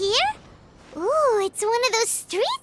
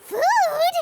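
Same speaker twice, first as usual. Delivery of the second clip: eager, bright question, pitched up and louder; excited; 7 semitones higher, 3 dB louder than usual. Acted. Paimon perks up when a meal is offered, eager excited question. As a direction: perk up at the word food.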